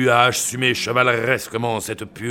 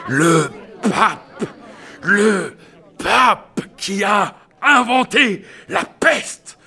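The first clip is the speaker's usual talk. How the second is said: shouted revelation; 6 semitones higher, 5 dB louder, slower than usual. Acted.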